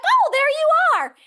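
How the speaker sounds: father finding his daughter on screen, thrilled; delighted recognition. Retold or imitated. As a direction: cry out with joy at finding her. Father spots daughter's match.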